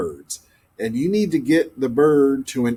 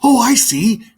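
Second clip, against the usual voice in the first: bright, higher quick reply; mild cry; 9 semitones higher, 4 dB louder than usual. Acted. Blackbird catching on to the lesson, a light cry. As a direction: pipe up as if you just got it. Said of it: "cried the blackbird".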